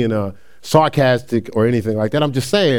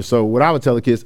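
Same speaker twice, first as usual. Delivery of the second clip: ordinary talk, nothing marked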